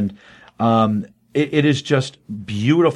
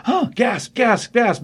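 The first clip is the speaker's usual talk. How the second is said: high, hammed-up spoken gasps; mock gasping; 10 semitones higher, slower than usual. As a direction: play a whole crowd gasping in pretend awe. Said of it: "everybody goes".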